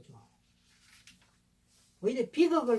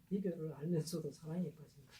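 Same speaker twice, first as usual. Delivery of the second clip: low, soft quote of the lyric; hushed revelation; 6 semitones lower, 9 dB quieter than usual. Shown as a demonstration. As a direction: drop low and quiet, letting the line fall. Lyric repeated slowly as the revelation. (낮고 조용하게 읊조리듯)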